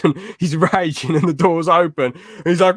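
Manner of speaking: ordinary talk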